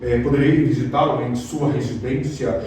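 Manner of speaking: a lightly voiced stuffy young man; mock formal speech. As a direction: parody of churchy formality. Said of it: "um rapaz vai falar".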